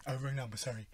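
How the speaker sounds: quiet flat mock caller; deadpan copy of the man's reply; understated imitation of a stranger; imitating the caller. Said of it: "he actually fell for it".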